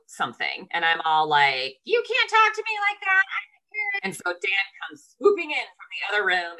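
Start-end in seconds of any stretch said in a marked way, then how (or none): 1.8-4.0 high-pitched, indignant mock scolding; arguing parent. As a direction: act out an outraged mom scolding her son. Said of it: "I was in an argument".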